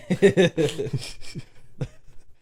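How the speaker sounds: airy laugh; breathy laugh. Real, not acted. Laughing at Scary Movie.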